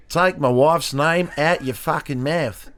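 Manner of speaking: slow, hammed-up Australian accent. Shown as a demonstration. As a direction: stretch it out in broad mock Australian. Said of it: "Um, Australian".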